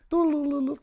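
high, sung-out "du-lu-lu" ringtone; phone ringing imitation. Co-host plays the telephone before the call, high imitated ringing. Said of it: "Det er telefonlyden".

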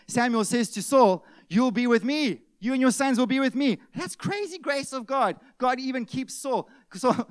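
loud, high-pitched pulpit preaching; preaching at full force. Acted. Preacher warning the church about missing the kingdom, loud and urgent. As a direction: drive the warning home to the congregation. Preacher building on Saul's story.

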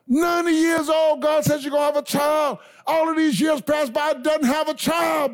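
ramp the sermon up to a shout; full-force preaching. Real, not acted. Preacher building to a shout about Abraham.

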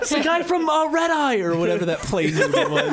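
high, laughing through words. Real, not acted. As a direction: talk while cracking up. Still laughing while speaking.

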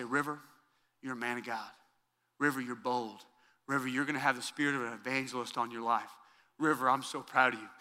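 put-on soft, hushed blessing spoken close to a child; sincere blessing. Shown as a demonstration. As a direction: speak it softly to your son face to face. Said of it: "I grab 'em sincerely".

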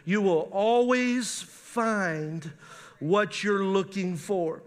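very slow, every word weighed; slow deliberate sermon emphasis; slow emphatic repeat. Shown as a demonstration. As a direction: drag it out so they write it down. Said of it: "Write that down".

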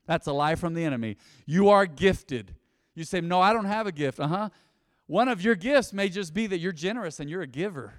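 usual speaking voice

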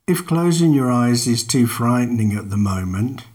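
ordinary delivery

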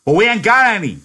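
very high, loud angry blast; exploding in anger. Acted. George exploding at Lenny over ketchup, furious. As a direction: explode at him. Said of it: "George exploded".

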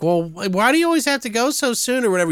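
high, rushed mom voice; complaining mother. Retold or imitated. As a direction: whine at a son in a hurry. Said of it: "she's like".

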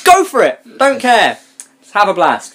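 high, punchy dare to the listeners; defiant shout. Real, not acted. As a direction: throw it out loud and high, daring them. Mock invitation to mockers.

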